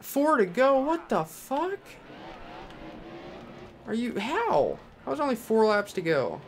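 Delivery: high, stunned questions; outraged disbelief. Real, not acted. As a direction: cry out that it can't be true. Disbelief at few laps left.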